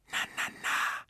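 hushed, soothing whisper; barely voiced little whisper. A narrator's reading. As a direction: whisper it softly like tiny comforters. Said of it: "vernahm er da ein Wispern".

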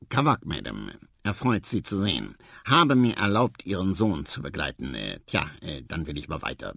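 low, courteous voice faltering at the end; formal, awkward marabou. A narrator's reading. Marabou bowing to the mother, low awkward formality. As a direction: introduce yourself stiffly, then sink into embarrassed hesitation.

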